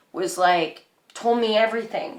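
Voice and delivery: ordinary talk, nothing marked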